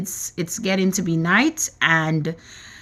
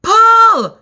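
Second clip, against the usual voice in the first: high, loud one-word command; shout; 13 semitones higher, 9 dB louder than usual. A narrator's reading. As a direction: bellow the order to pull. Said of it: "shouted the old green grasshopper".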